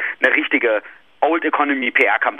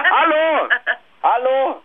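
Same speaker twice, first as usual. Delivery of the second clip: calling out; 14 semitones higher, much slower than usual. Real, not acted. Calling listeners, drunk.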